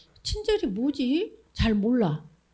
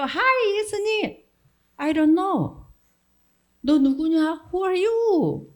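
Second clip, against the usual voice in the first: mock dismissive Jesus; 6 semitones higher, much slower than usual. Jesus rejecting the false believer.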